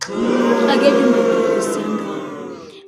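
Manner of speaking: slow and loud; stretched-out protest at the friend; loud accusation. Retold or imitated. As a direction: lean hard on each word. Retold angry retort, dragged out.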